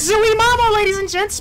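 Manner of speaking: high, loud showman's holler; triumphant whoop. Real, not acted. Gamer showing off to ladies and gents, triumphant whoop. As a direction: holler it out in celebration.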